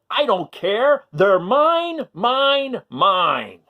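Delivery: high, loud greedy yell. A narrator's reading. Minosaur yelling at classmates over toys, high and loud. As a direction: yell it like a spoiled dinosaur. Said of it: "the Minosaur yelled".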